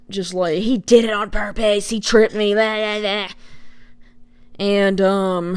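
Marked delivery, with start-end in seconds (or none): 0.6-3.3 lightly mocking, insistent complaint; doubling down. Retelling aunt doubling down on her accusation, mild mock insistence. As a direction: rattle off the aunt's grievance dismissively. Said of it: "my aunt just kinda doubles down".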